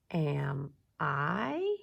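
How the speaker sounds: "Am I" in slow separate words, "I" sweeping up; title read as a question, rising on "I". Shown as a demonstration. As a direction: lift "I" at the end to ask. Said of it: "my voice goes up a little bit".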